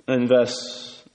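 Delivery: ordinary delivery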